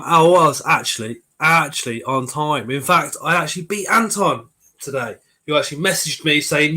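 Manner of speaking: ordinary delivery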